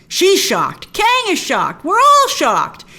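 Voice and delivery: building to a high, loud cry; mock shock. Real, not acted. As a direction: escalate each phrase in comic astonishment. Comic escalation of everyone's shock.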